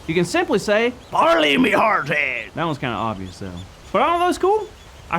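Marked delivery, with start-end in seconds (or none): 1.1-2.4 slow gruff pirate offer; pirate talk. Shown as a demonstration. [name] teaching kids pirate lingo, slow pirate voice. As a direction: drag it out like a pirate calling truce. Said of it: "to talk like a pirate".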